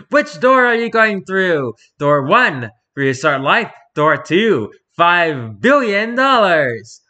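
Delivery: loud, slow game show announcer; game show host. Acted. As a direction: announce each door like a TV host. Reads a game-show style choice.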